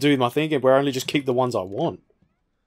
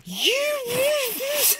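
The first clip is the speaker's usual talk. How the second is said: squeaky voice; slower than usual. Pretend. Squeaky teasing at a new enemy.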